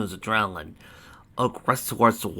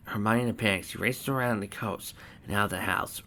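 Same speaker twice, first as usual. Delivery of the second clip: ordinary delivery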